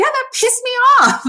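playfully indignant squeal of admission; mock annoyed outburst. Acting out honest annoyance versus serenity.

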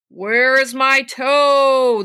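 the ghost right outside, moaning; slow, swelling corpse wail; looming ghostly groan. Acted. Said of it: "the voice groaned".

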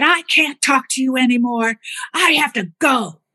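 lightly voiced mom cutting the call short; imitating mom storming off. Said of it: "and hung up the phone".

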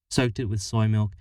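ordinary delivery, nothing marked

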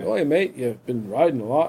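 softly imitated English accent; crazy English accent. Old bearded cyclist greeting a rider, mock English accent. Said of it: "he has this crazy English accent".

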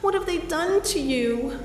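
high, anguished cries over her friend; horrified at what was done to Anita; shocked, desperate pleading; distraught. Acted. Finding her sedated friend.

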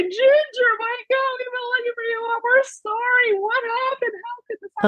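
very high, tearful pleading; crying. Retold or imitated. Family at the vet, tearfully pleading with the found cat. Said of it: "We're all crying".

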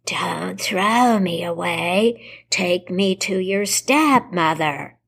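slow, muffled voice from within; muffled skull voice. Acted. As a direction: speak as if from inside a skull. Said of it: "a muffled voice come from the skull".

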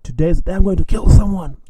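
hammed-up declaration; mock threat. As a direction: announce it as a comic imitation of rage. Imitating the drunk friend's threat.

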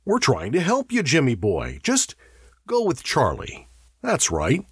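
high-pitched, placating coaxing. A narrator's reading. Davies to Jimmy, helping him go with Charlie, coaxing. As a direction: humour him along. Coaxing a sick man along.

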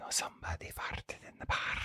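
very soft, voiceless haunting whisper; ghostly whisper. Pretend. Friend's ghost haunting her old classmate, ghostly whisper. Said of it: "her ghost to haunt me by saying".

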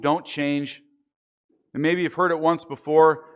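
usual speaking voice

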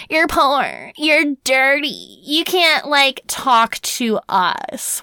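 slow, drawn-out mock snob; lightly voiced snooty townswoman scolding travelers; snooty mock local. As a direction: sneer it down at the outsiders like a snobby townswoman. Mocking the locals' snobbery.

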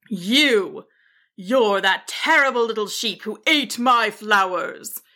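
lightly snarling wizard voice; a touch of wizardly anger on each accusation; mild snarl. A narrator's reading. Wizard accuses the sheep who ate his flowers, snarling. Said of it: "he snarled".

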